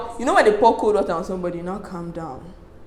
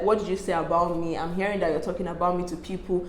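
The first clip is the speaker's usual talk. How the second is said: calm; 3 semitones lower, 8 dB quieter than usual. Retold or imitated. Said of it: "This, the way I'm talking now, this is how this conversation started. Calm".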